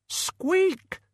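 very high sharp squeak; urgent rat squeak. A narrator's reading. Death of Rats squeaks urgently.

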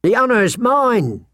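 high, swooping obsequious reply; gushing merchant. Acted. Merchant returning a princess's thanks, high and gushing.

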